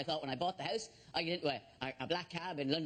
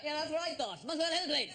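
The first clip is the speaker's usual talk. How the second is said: high mock polite; 8 semitones higher than usual. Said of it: "I go".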